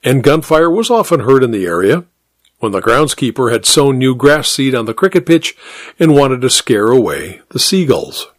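usual speaking voice

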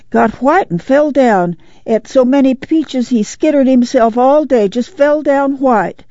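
put-on high, excited little-girl voice; lightly acted breathless child telling on someone; panted report. A narrator's reading. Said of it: "Ruthie panted".

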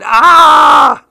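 loud, very high held scream; frightened scream. A narrator's reading. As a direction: scream at imagined monsters. Child imagines monsters in closet.

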